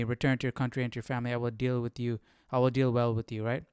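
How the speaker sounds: ordinary delivery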